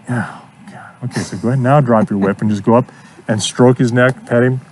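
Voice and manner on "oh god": a breathy, barely voiced "Oh, God"; horse's weary sigh. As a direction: voice the horse as mildly exasperated. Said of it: "He's like".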